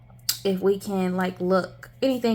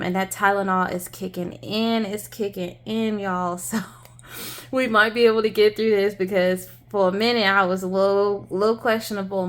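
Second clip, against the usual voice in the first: ordinary delivery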